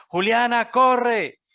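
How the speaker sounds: high, loud yell of a name and an order; shouted demo. Shown as a demonstration. Father yelling at Juliana, a high loud shout. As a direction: call out as if to a child running off. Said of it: "cuando en los diálogos queremos gritar".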